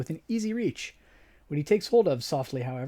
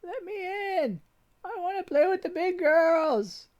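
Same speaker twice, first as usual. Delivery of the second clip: put-on high, slow child begging; a small girl left out by older girls; high whiny child voice; pleading little girl; slower than usual. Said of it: "reliving memories of early childhood".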